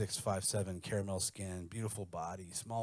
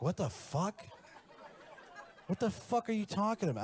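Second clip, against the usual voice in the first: high shocked outburst; 10 semitones higher than usual. Retold or imitated. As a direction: freak out at the idea. Girlfriend shocked at request.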